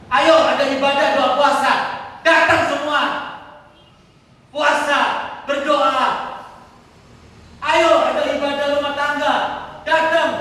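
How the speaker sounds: high, loud imitated rallying call. Shepherd inviting his congregation to services, calling out.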